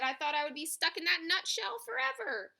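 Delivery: high voice of a small creature; relieved little mole. A narrator's reading. Mole just freed from a nutshell, relieved. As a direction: pipe up with relief.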